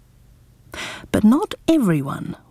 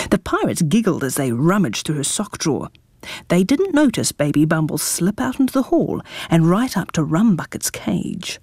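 ordinary delivery, nothing marked

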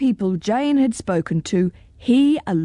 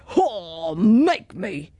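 understated dragon roar; a mock roar at normal volume; lightly roared dragon taunt; much slower than usual. A narrator's reading. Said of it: "he roared".

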